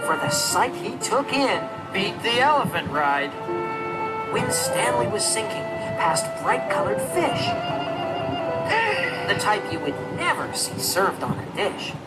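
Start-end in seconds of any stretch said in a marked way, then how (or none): none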